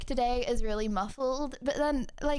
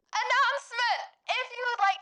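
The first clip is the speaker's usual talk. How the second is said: high, bright call-out; excited announcement; 8 semitones higher than usual. Acted. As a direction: announce it to the room. Calls out announcement to chat.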